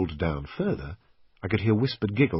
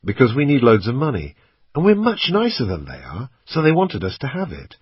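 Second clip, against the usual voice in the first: louder, rising fake cheeriness; fake sweet; 6 semitones higher, 7 dB louder than usual. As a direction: lie breezily to a child. Lying sweetly to Kelly.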